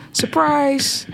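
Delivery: high excited surprise call; sudden high cheer of Surprise; party shout. Acted. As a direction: yell it like the guests at a surprise party. Surprise party scene acted out.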